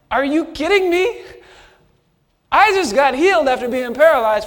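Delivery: loud, high, incredulous outburst; exasperated retort. Retold or imitated. Healed man snapping back at the leaders, loud exasperated disbelief. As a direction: let the disbelief burst out.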